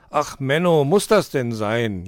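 drawn-out, loud whine; whining. A narrator's reading. Dominik objects to setting off today, whining. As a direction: moan it like a put-upon apprentice. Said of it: "jammerte Dominik".

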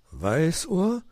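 drawn rising hoot of a question; questioning owl. A narrator's reading. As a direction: ask in puzzlement, sliding up. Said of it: "fragte Onkel Uhu".

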